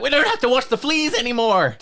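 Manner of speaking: high, loud gleeful cheer; mock relief. Audiences freed from fleas by TV, loud mock cheer. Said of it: "we're like".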